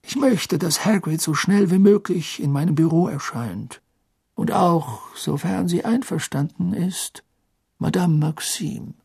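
lightly voiced kind old headmaster; Dumbledore voice. A narrator's reading.

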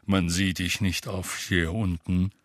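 low, slow graveside voice; sepulchral voice. A narrator's reading. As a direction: speak like a mournful undertaker. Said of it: "mit Grabesstimme".

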